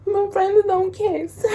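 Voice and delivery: high, slow whine; drawn-out, high-pitched pout; mock whining. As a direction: protest it like a pouting crybaby. Re-enacts tearful protest at breakup.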